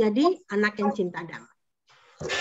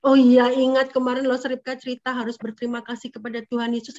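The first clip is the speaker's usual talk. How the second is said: ordinary delivery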